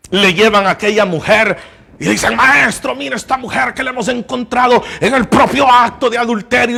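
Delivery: loud, high impassioned sermon voice; fervent preaching. Acted. Preacher urging his congregation, loud impassioned preaching. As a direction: preach it hard to the church.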